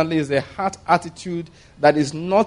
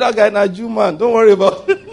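laughing God voice; 5 semitones higher, 5 dB louder than usual. Acted. God voiced, teasing Peter.

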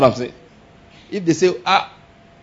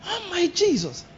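shocked praise; 8 dB quieter, slower than usual. Shown as a demonstration. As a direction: gasp out faith in shock. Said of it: "you keep on shouting it".

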